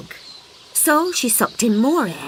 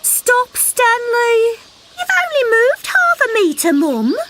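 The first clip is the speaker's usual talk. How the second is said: high, loud little frog calling for his mum; excited calling frog; 5 dB louder, slower than usual. Acted. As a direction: call out eagerly, high and bright.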